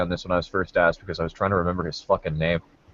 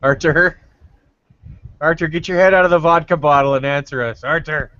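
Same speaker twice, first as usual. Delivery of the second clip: higher-pitched, mock-scolding summons; calling out; 7 semitones higher than usual. Real, not acted. Host nags an unresponsive co-host for an answer, calling out. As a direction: call out to rouse an absent friend.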